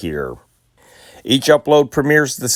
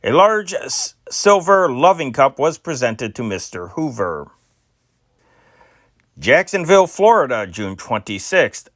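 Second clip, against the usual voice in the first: put-on brisk old-time newsreel read, higher than his chat; news read; 5 semitones higher than usual. Host reading old newspaper stories to listeners, crisp news announcer read. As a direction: announce each item like a radio newsman.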